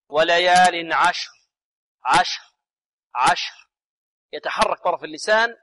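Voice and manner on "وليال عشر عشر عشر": slow وليال عشر with the final r only breath; unvoiced final ra. Shown as a demonstration. Teacher imitates students' error, voiceless r ending عشر. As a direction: drop the voice out of the r in عشر. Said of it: "لكن بدون جهر".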